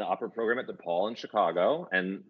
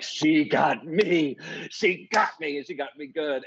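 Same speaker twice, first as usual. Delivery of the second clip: amazed disbelief; 7 semitones higher, slower than usual. Retold or imitated. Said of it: "And I was like".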